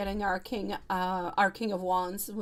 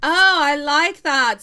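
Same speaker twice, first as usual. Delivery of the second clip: delighted; 8 semitones higher, 13 dB louder, slower than usual. Real, not acted. Reacting delighted to a chat comment.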